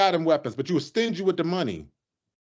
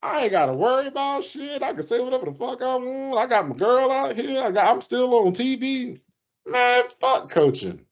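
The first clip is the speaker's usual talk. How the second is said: mock carefree Belichick; 7 semitones higher than usual. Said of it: "He like".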